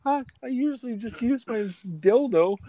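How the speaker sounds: high falsetto mimicry; imitated scared girl. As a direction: make excuses in a put-on girly voice. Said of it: "they're like".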